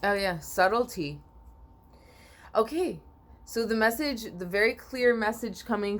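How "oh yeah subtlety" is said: usual speaking voice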